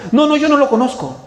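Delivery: loud, high, flustered no; heated denial. Retold or imitated. Preacher voicing Peter denying Jesus to a woman, heated. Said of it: "empezó a maldecir".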